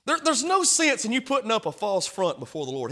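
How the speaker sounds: usual speaking voice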